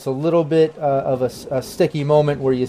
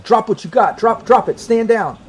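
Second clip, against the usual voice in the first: high, loud repeated orders; soldier's command; 9 semitones higher, 3 dB louder than usual. Acted. Soldier repeats his orders at the cornered team, high loud command. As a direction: shout it again and again with rifle raised. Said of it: "the other guy, like".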